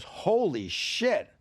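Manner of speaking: high, loud, slowly stretched exclamation; amazed sculptor. Retold or imitated. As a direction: draw it out in astonishment. Sculptor amazed by student's work.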